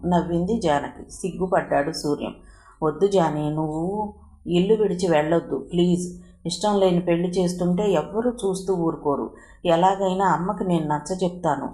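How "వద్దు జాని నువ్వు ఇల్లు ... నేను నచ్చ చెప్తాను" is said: plain reading voice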